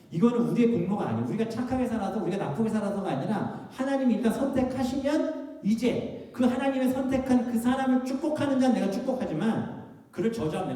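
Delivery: high, loud sermon climax; impassioned preaching. Real, not acted. Preaching climax at full force. (힘주어 높게 외치는 설교)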